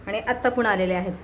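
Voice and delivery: normal talking voice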